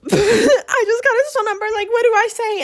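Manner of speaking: high, loud, laughing squeal; giddy excitement. Retold or imitated. Telling her friend she got his number, giddy squeal.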